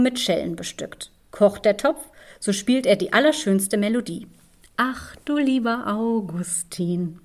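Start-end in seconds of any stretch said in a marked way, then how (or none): none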